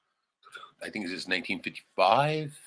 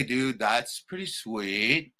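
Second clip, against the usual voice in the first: ordinary delivery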